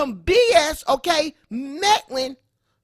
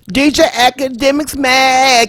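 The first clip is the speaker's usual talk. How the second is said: loud vlog rant; 10 dB louder than usual. Acted. Reacts over loud music.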